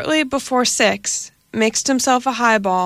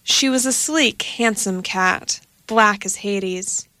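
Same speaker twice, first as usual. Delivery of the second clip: plain reading voice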